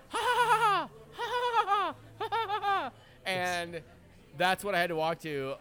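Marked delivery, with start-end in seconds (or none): 0.1-2.9 high, piercing mock cackle imitating birds; mocking bird laughter. Imitating the birds he hears after the lost match, high mock cackle. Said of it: "but all I hear is".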